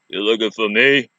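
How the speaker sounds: low tough-guy Rambo growl. Retold or imitated. Woman imitating Rambo while recapping the movie, in a low tough growl. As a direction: lightly growl it like an action hero. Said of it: "like a Rambo kind of thing".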